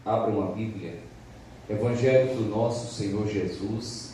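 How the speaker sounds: ordinary talk, nothing marked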